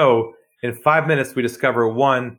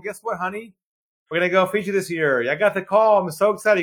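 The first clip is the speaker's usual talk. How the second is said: higher, lighter gushing; mock excited; 7 semitones higher than usual. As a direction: play the giddy spouse-teller. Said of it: "Go back home".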